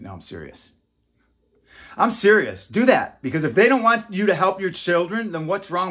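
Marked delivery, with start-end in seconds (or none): 0.0-0.7 very soft, low insistence; quietly serious. Real, not acted. Taking back the joke to parents, quietly serious. 2.0-3.2 higher, louder insistence; emphatically serious. Real, not acted. Urging viewers to help their children, emphatically serious.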